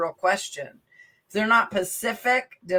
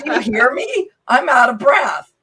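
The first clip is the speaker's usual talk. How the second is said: retold exasperation; 5 semitones higher, 8 dB louder than usual. Telling her trainer friend she was winded, mock insistent. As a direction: replay the moment you had to insist. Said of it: "And I said".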